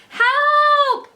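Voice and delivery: very high, loud yell; cry for help. A narrator's reading. Fudge yelling help as his brother charges. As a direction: shriek for rescue. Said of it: "Fudge cried".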